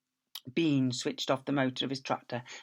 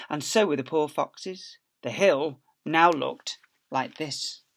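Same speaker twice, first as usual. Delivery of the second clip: ordinary delivery, nothing marked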